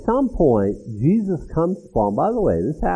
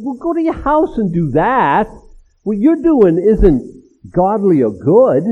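ordinary delivery

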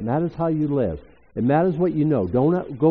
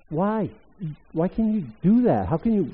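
ordinary talk

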